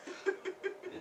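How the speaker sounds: soft, menacing little chuckle; sly chuckle. Acted. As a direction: chuckle quietly after the mock reassurance. Mocks his own civility.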